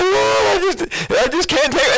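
hammed-up sob to the Lord; mock crying plea. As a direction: pretend weeping at the end of your rope.

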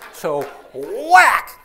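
loud whack sound effect; mock whack. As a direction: slam out the hit noise. Sound of hitting retold.

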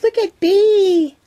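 gushing delight; excited squeal. Real, not acted. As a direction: squeal her name in delight. Thrilled by the gift.

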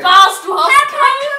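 loud high punchline; excited reveal. Real, not acted. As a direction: shout the joke's reveal. Lacht laut auf Pointe. (laut und hoch die Pointe rufen)

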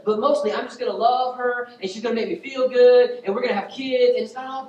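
high, loud, escalating wish list; mock fervent prayer. Preacher imitating a self-serving prayer to God, mock fervent. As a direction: plead eagerly at full preaching force.

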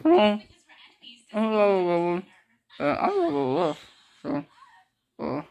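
talking through mouth gauze; muffled by cotton wadding. Real, not acted.

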